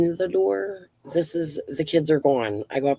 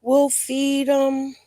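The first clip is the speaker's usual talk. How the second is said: slow, drawn-out mock of empty promises; lightly imitating family members' hollow promises; 8 semitones higher, much slower than usual. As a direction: stretch it out sarcastically.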